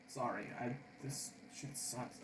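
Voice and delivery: whispered under the breath, slowed; whispered gripe. Real, not acted. Frustrated at the puzzle, whispering a gripe. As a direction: mutter it so nobody hears.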